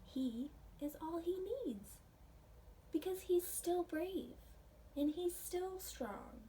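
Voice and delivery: plain reading voice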